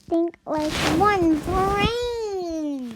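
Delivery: very slow, drawn-out words. Acted. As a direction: stretch every word out. Rallying the friend to think.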